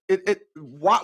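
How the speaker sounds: ordinary talk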